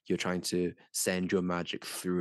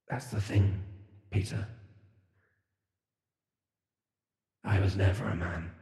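familiar voice. Acted. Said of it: "you hear a very, very familiar voice say".

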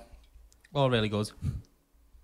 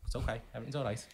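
calming down; 4 semitones lower, 10 dB quieter than usual. Retold or imitated. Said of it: "she went, I thought".

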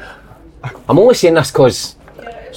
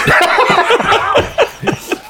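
airy squeal of laughter; high breathy laugh. Real, not acted. Laughing at the hot wings.

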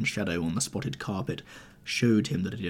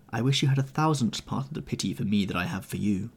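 usual speaking voice

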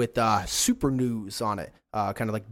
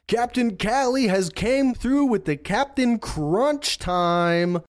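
high, loud, slow broadcast hype; hyped announcer; 10 semitones higher, 5 dB louder, slower than usual. Acted. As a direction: announce it like a sports highlight. GM voices drone announcer.

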